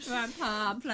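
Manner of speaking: pamphlet, with a Welsh accent and a half laugh. Shown as a demonstration. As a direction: Welsh pamphlet again, giggling. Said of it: "try to say pamphlet as Welsh as possible".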